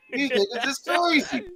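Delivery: high, slow ventriloquist dummy; squeaky puppet talking slowly. Retold or imitated. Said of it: "My ventriloquist skills on point".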